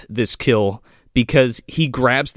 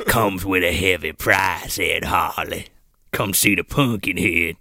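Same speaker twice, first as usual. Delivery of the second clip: deep ominous voice; 10 semitones lower, 5 dB quieter than usual. Retold or imitated. Mock Harley narration, deep.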